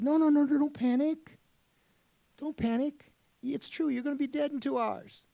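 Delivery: high and loud no no no, settling down; urgently soothing a panicked friend; Jesus calming George. Acted. Jesus tells horrified George not to panic, high and urgent.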